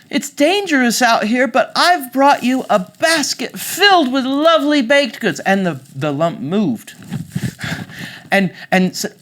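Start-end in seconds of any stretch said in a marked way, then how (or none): 0.1-5.4 put-on high, loud, eager little girl. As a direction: call out sweetly to grandmother, pitched high.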